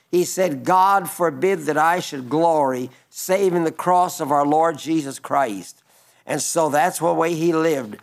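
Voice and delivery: usual speaking voice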